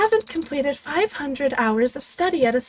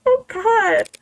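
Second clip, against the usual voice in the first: shocked disbelief; 10 semitones higher than usual. Real, not acted. Shocked reaction to flashback.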